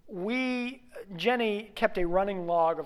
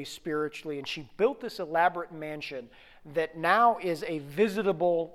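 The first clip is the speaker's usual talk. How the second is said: ordinary talk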